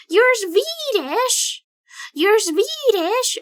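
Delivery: high, loud, slow exclamation; surprised realization. Real, not acted. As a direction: stretch it out in shock.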